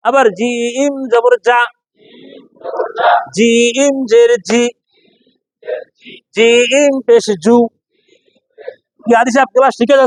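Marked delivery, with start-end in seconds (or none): 0.1-7.6 জিম drawn long, hard j in যা, জি, জু; slow drill, জিম then a firm syllable; firm jim. Shown as a demonstration. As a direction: stretch each জিম and hit the j from mid-tongue. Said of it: "শক্তের জো".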